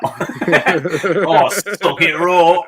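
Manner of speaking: loud, high, drawn-out Cockney through laughter; mock sexy Cockney. Painter obliges friend's wish for sexy talk, mock Cockney. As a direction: ham up raunchy Cockney, laughing. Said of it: "sexy talk from a Cockney".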